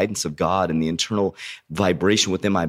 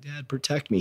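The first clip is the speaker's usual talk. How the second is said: put-on scared little boy; 8 semitones higher, 6 dB quieter than usual. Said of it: "He's like".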